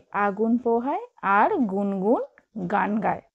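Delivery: slow, careful reading of a primer text; reading to children. Acted. Teacher reading the primer lesson to young pupils, slow and careful. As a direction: read it aloud for small pupils, slowly.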